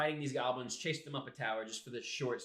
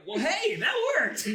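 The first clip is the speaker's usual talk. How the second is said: laughing surprise; 9 dB louder, slower than usual. Retold or imitated. Party's surprised reaction.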